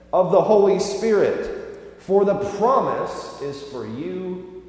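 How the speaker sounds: high, loud, slow preaching delivery; scripture proclamation. Acted. Preacher declaring Peter's promise to the congregation, slow and ringing. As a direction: proclaim each phrase with weight, building to the last word. Reads Peter's words aloud from Acts.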